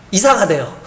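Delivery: usual speaking voice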